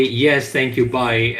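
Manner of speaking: normal talking voice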